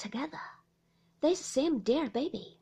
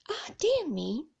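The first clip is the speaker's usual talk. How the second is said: mild weary sigh; a lightly sighed lament; 5 semitones higher, 4 dB louder than usual. A narrator's reading. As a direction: say it with a touch of a sigh. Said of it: "sighed Miss Murdstone".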